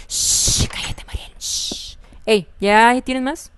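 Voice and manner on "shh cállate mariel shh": susurrado y sin voz, mandando callar; chitón susurrado como quien calla a alguien; susurro. Retold or imitated. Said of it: "alguien me dijo". (susurro que manda callar)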